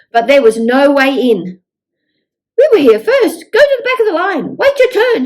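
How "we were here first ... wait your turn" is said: higher, louder, fast jostling yells; shouting crowd. Acted. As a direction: bark it like an impatient crowd. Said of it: "shouted the crowd".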